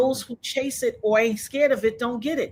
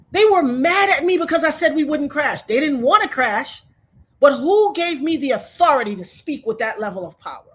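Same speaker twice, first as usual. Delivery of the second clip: usual speaking voice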